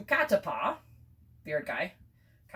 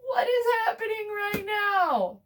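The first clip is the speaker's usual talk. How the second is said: much higher, louder, crying out in mock despair; hammed-up sobbing wail; 3 dB louder than usual. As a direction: wail like a betrayed crowd. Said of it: "and everyone's like".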